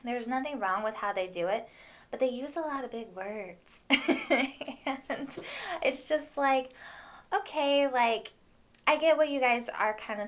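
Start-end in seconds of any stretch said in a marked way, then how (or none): none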